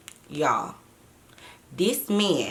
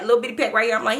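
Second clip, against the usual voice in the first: normal talking voice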